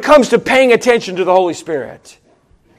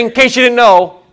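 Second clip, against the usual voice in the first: usual speaking voice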